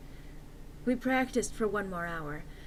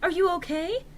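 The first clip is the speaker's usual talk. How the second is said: concerned friend; 8 semitones higher than usual. A narrator's reading. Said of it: "asked in a concerted voice".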